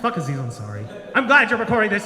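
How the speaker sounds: ordinary delivery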